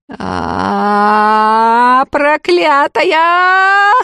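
high, slow shrill scream; wild shriek. Acted. Fyokla at the witch, wild drawn-out shriek. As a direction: shriek it like a madwoman. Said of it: "дикий визг Фёклы".

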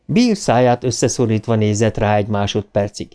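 ordinary delivery, nothing marked

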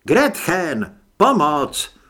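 loud urgent call for help. A narrator's reading. Trip calling Gretchen to the wound, loud and urgent. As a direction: call the healer across the crowd.

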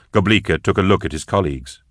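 plain reading voice

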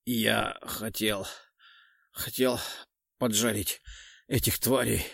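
hoarse, choking on blood; bloody mouth. A narrator's reading. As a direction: gurgle through a mouth of blood. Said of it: "хрипло проговорил он, захлёбываясь кровью".

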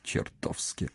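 whispered, amused admission; hushed and wryly amused; amused chuckling reply. A narrator's reading. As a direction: murmur it with a smirk, almost voiceless. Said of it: "усмехнулся он".

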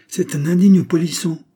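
high, loud outburst; scandalised mother's exclamation; indignant exclamation. A narrator's reading. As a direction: cry out in outrage. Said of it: "s'écria madame de Géry".

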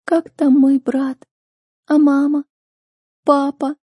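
put-on high, slow, weeping girl; tearful anguish. A narrator's reading. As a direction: ask after family in a high, crying voice. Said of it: "вновь заплакала так надрывно и мучительно". (высоко, медленно, со слезами)

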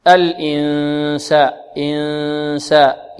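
"الإنساء" very slowly, humming the n; held nasal sound. Shown as a demonstration. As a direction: stretch the nasal n in "إنساء". Demonstrates the nasal hold. ("إنساء" بغنة ممدودة)

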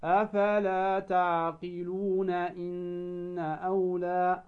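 slow chanted recitation; recited with two-beat madd. Shown as a demonstration. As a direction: recite slowly, holding the madd two counts. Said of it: "لا بد أن تأتي بالمد بمقدار حركتين".

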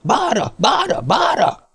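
high, drawn-out cry; urgent high-pitched chant; calling out. A narrator's reading. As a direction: call it out high and stretched. Cry echoed before Lucy screams.

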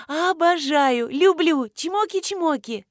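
high, drawn-out, effusive farewell; gushing goodbye. A narrator's reading. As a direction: gush affection down the phone. Gushing goodbye to friend on phone. (восторженно сюсюкая на прощание)

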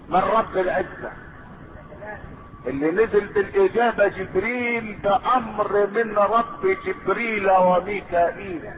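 ordinary talk

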